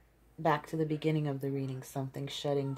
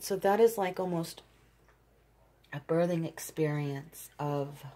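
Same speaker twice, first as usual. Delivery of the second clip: ordinary talk, nothing marked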